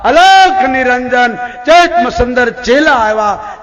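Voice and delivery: high, loud, long-drawn holy cry; guru's summoning call. Acted. Guru summoning his disciple from the refuse heap, calling out. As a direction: call the disciple awake, stretching the words.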